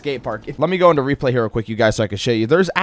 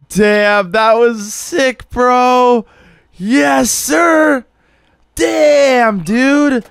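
high excited cheering; frat bro; 9 semitones higher, 4 dB louder, much slower than usual. Real, not acted. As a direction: hype it up like a bro. Cheering watching the replay.

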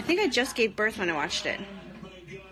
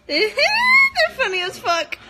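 high loud laugh; laughing hard; 10 dB louder than usual. Real, not acted. Watching the sketch, loud high laughter. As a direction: burst into a squealing laugh. Reacting to Trump kids sketch.

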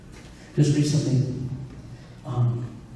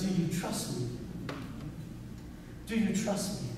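soft, hushed question from God; 14 dB quieter than usual. Retold or imitated. God quietly asking the pastor if he trusts him, hushed. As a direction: let God ask it gently, almost under the breath.

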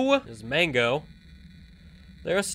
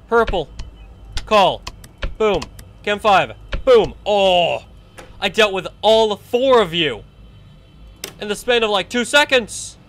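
hyped triumph; 4 semitones higher, 5 dB louder than usual. Real, not acted. Fends off all four animatronics.

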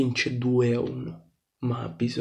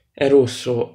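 loud emphatic call, "È rosso" punched; 10 dB louder than usual. Acted. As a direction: shout "È rosso" like a referee verdict. Red card repeated, emphatic.

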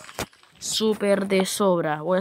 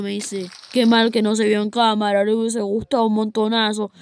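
ordinary talk, nothing marked

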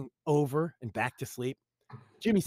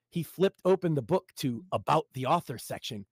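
ordinary delivery

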